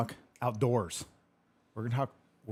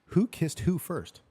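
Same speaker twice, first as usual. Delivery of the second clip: WHO pitched higher, drawn out slowly; deliberate question; 8 semitones higher, 3 dB louder, slower than usual. Retold or imitated. Host reading a viewer's question, slow and deliberate. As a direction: slowly read out "who kissed WHO first". Reads question, then corrects the wording.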